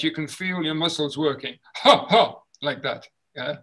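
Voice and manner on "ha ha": short loud ha ha pushed from the diaphragm. Shown as a demonstration. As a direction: feel the tummy kick. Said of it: "when we go".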